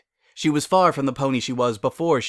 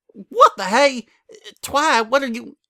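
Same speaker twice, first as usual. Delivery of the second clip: startled; 12 semitones higher, 6 dB louder than usual. Acted. Suddenly hugged by Twilight.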